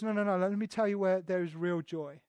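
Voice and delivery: preacher voicing God gently, soft and warm; gentle God. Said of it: "God says".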